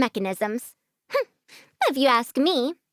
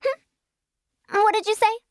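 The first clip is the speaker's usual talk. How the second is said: hard of hearing; 10 semitones higher than usual. Acted. Old woman feigning poor hearing.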